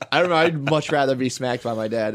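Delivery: usual speaking voice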